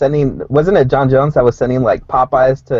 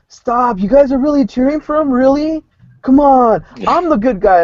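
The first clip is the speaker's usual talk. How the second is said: much higher, whiny begging to the crowd; mock pleading DC; 11 semitones higher than usual. Cohost imitating the fighter begging fans at a press conference, whiny. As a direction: plead like a hurt good guy nobody cheers. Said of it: "he was pleading with everybody".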